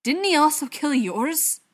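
high, loud accusing question; heated, insistent challenge; pressing. A narrator's reading. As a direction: push hard for an answer. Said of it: "Butcher pressed".

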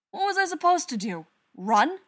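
loud, high pleading outburst; defensive shout. Acted. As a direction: yell back in self-defence. Brother shouting back in defence.

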